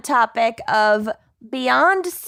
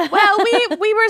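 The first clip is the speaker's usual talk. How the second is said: laughing, higher voice; 10 semitones higher, 4 dB louder, faster than usual. Real, not acted. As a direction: talk through a laugh. Laughs while answering.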